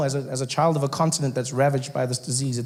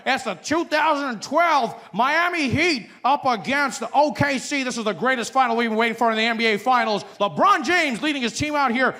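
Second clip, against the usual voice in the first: higher hyped announcer read; sportscaster; 7 semitones higher than usual. Acted.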